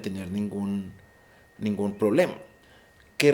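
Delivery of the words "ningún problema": ordinary talk, nothing marked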